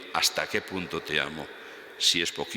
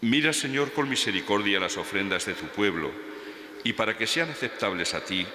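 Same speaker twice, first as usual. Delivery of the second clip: raised, solemn priestly intoning; liturgical intoning; 5 semitones higher than usual. Acted. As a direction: lift each phrase like a celebrant at Mass. Priest intones the offertory prayer. (entonación litúrgica solemne y elevada)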